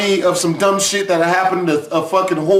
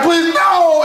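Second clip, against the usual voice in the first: mock crying plea; 8 semitones higher than usual. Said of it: "someone gonna be like crying".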